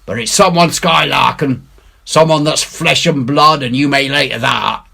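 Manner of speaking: rally the crew with returning courage; Silver rallying. A narrator's reading. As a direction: bluff reassurance. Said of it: "His courage had come back".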